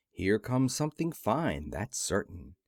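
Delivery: ordinary delivery, nothing marked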